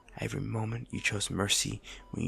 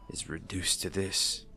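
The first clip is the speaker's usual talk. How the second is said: usual speaking voice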